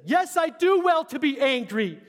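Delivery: high, loud, slow huffy retort; indignant Jonah. Acted. Jonah answering God about the plant, mock indignant. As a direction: snap back at God in a sulk.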